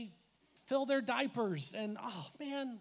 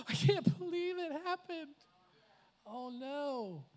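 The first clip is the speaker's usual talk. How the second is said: acted disbelief; 6 semitones higher, slower than usual. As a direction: play the stunned disciples, voice jumping high. Disciples shocked at the resurrection.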